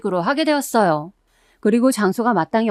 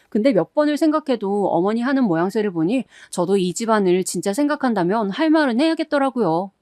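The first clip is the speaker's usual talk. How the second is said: ordinary delivery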